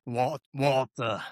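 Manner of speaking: slow, drawn-out Walter, lightly voiced; a hint of Walt Junior stretching the name. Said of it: "you would do Walt Junior's voice".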